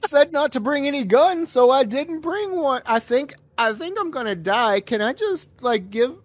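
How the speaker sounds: high, hammed-up wounded disbelief; mock surprised whine. Mocking the shot villain's surprise: high hammed-up whine. Continuing the dying man's voice.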